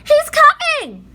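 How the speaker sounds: high, loud, slow warning cry; frightened shout. A narrator's reading. Annie warning Jack of the T-rex, frightened shout. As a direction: warn him the dinosaur is near. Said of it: "shouted Annie".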